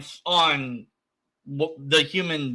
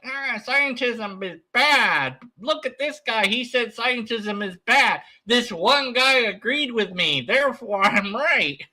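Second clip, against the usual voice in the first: mock smug believer; 7 semitones higher than usual. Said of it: "It's just like".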